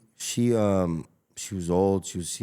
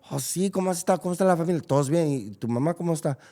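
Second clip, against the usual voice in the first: friendly small talk; 9 semitones higher, faster than usual. Retold or imitated. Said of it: "I'll be like".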